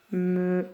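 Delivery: Melon softly as me... lon, long gap; softly part the two syllables of "Melon"; split into syllables. Shown as a demonstration. Teacher checking two syllables with pupils, Melon softly split. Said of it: "deux syllabes".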